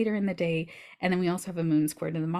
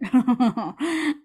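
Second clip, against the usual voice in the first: louder, higher put-on laugh; mock laugh; 6 semitones higher, 5 dB louder than usual. Acts out the moment she rebelled.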